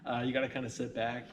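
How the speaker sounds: laughing while repeating the line; amused, laughing through the words. Real, not acted. Laughing echo of the host's phrasing.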